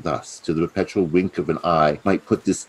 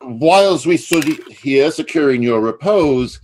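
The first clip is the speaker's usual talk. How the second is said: higher, louder, rushed lie; hasty excuse; 9 semitones higher, 7 dB louder than usual. Acted. Plotter caught with drawn sword, rushed flustered excuse. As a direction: cover your tracks in a flustered rush.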